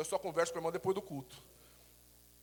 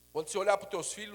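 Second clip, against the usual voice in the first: ordinary talk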